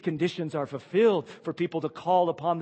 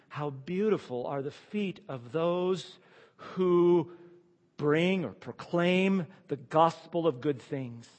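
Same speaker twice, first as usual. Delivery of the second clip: slow scripture quote; 3 semitones higher, slower than usual. Acted. Quoted verse read slowly.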